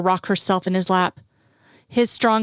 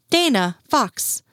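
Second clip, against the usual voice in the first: lightly voiced call, higher and drawn out; 5 semitones higher, slower than usual. A narrator's reading. Mother spots them across the yard, lightly calling out. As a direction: hail two people with slow, high-pitched names.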